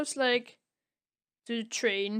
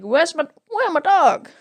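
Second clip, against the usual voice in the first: a loud, higher call for the lost dog; calling out loudly; 7 semitones higher, 12 dB louder than usual. Retold or imitated. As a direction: shout out for the missing dog, worried. Tintin calling for his dog.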